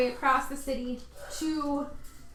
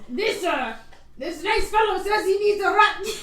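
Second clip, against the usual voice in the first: put-on laughing accent; 7 semitones higher, 7 dB louder than usual. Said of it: "I can't do this accent".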